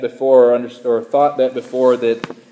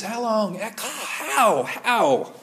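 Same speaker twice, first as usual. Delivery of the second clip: high, soft pleading fading off; lament; 8 semitones higher, 6 dB quieter, much slower than usual. Retold or imitated. Pastor voicing the psalmist's fading lament, soft and pleading. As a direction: ache out the question as it fades away. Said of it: "And here we have this".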